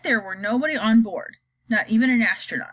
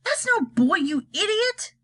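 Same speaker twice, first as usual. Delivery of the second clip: angry shout; 9 semitones higher, slower than usual. Acted. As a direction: yell at the fool. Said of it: "shouted ground control".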